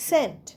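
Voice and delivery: সেন্ট said as sent, no k sound; সেন্ট with the c left silent, just s-e-n-t; silent c in scent. Shown as a demonstration. Teacher models the word for students, সেন্ট said cleanly as sent. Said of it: "সেন্ট বলছি".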